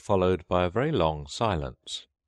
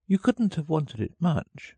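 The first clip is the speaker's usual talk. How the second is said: put-on high, light girl's voice; Alice voice; 12 semitones higher than usual. A narrator's reading. Alice doubting the turtle needed washing, in a high voice. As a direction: speak up as a matter-of-fact child.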